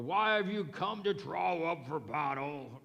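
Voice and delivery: lightly voiced giant challenger; Goliath's challenge. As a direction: call out like a giant taunting an army. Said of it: "I don't know if that's his voice".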